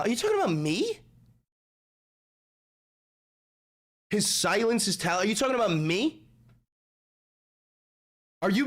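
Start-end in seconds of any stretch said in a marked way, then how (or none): 0.0-6.2 higher, theatrical rising question; dramatic high question. Acted. Streamer acting out a paranoid chat line, theatrically high.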